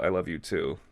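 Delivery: ordinary talk, nothing marked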